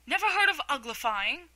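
loud, high astonished cry; exclaiming. A narrator's reading. Gryphon amazed Alice never heard of uglifying, loud exclamation. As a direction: gasp it out in disbelief. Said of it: "it exclaimed".

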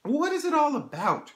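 high, loud petulant cry. A narrator's reading. Dorian flinging himself on the sofa, crying out petulantly. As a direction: burst out in a peevish, high-pitched complaint. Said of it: "in his petulant way".

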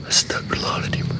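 barely audible whispered prayer; frightened whisper. Real, not acted. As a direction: breathe the prayer out in fear. Scared prayer in a dark toilet. (bisikan doa yang ketakutan)

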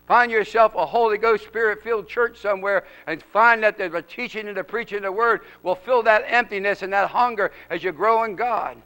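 ordinary talk, nothing marked

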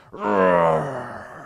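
monster roar. Acted. Narrator playing wild things for children, playful low roar. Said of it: "they roared their terrible roars".

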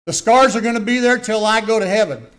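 high, loud preaching shout; fervent warning. Real, not acted. Preacher warning the children about scars, shouting.

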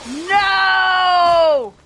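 panicked shout; desperate scream of no. Real, not acted. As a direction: yell no in real alarm. Screaming while being caught.

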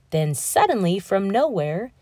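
usual speaking voice

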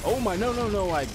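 high, loud panicked yelp of no, no; real alarm as he's about to die; panicked cry. Real, not acted. Gamer cries out in panic as he gets killed.

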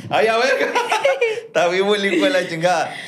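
high, cracking up while talking; laughing. Real, not acted. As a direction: talk through real bursts of laughter. Host cracks up at guest's dodge. (muerto de risa al hablar)

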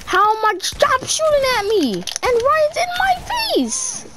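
frustrated yell. Real, not acted. Yelling during a gunfight.

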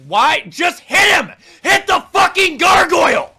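high, loud exasperated shouting; angry yell. Real, not acted. Player yells at his character to attack the gargoyle. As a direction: demand it at the top of your lungs.

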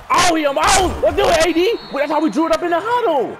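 high, loud celebration; thrilled shouting. Real, not acted. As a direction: crow over the big play. Celebrating a big play to Tony.

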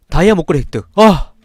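loud sudden joyful outburst; excited exclaim. Real, not acted. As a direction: yelp with delight. Finds item in horror game.

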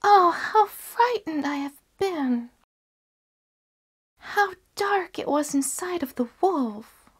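put-on high, shaken little girl's cry; frightened girl. Girl freed from the wolf's belly, high and frightened. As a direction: gasp it out, still scared from the dark. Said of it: "the little girl sprang out crying".

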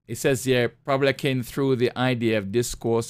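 usual speaking voice

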